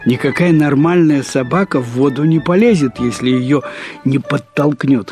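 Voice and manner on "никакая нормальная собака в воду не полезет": ordinary delivery, nothing marked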